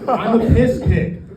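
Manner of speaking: loud, high comic ranting; mock anguished outburst. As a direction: shout it out as a hammed-up confession. Acted outburst of mock despair.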